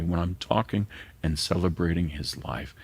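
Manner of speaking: ordinary talk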